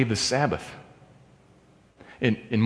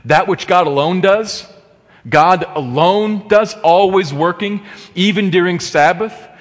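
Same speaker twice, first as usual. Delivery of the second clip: higher and louder, building preacher's fire; climbing sermon crescendo, pitch raised high; rising preaching fervor; 7 semitones higher, 3 dB louder than usual. Acted. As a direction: lift it into a fervent proclamation, climbing. Preaching crescendo on God's work.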